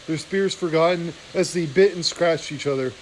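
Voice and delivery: plain reading voice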